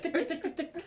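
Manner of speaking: high, soft ticking; mock ticking. Uncle Leo checking the watch, soft high ticking. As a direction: tick like a watch, lightly. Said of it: "He's like".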